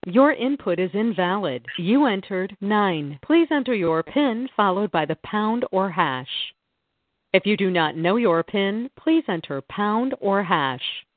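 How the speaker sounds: phone menu voice. Acted. Automated phone menu recording.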